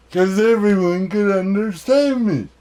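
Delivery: usual speaking voice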